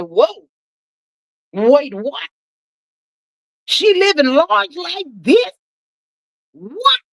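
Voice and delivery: high, slow, stunned disbelief; disbelieving surprise. Retold or imitated. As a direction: gasp it out, can't believe your eyes. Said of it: "they said".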